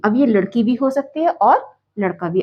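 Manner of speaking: normal talking voice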